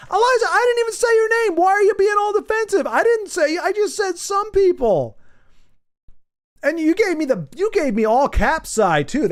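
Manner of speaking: playful high-pitched whine at a chatter; mock offended. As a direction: squeal the complaint like you've been wronged. Teasing a chatter for being defensive.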